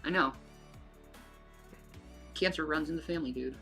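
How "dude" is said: ordinary talk, nothing marked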